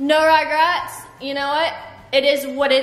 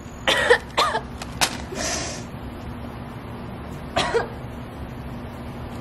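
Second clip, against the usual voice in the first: harsh coughing fit; choking cough; 8 dB quieter than usual. Real, not acted. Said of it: "I think I'm choking".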